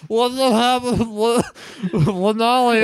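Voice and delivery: high, gurgled, muffled speech; garbled mock choking on the words; mouth full of blood. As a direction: imitate talking through a mouthful of blood. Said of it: "your mouth is filling with blood".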